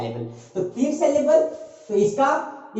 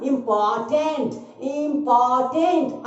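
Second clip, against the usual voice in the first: Important slow, every vowel sounded out; syllable by syllable; slower than usual. Shown as a demonstration. Teacher shows three vowel sounds, important in slow beats. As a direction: say important as इं-पॉ-टेंट, twice. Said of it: "आया ना तीन vowel sound".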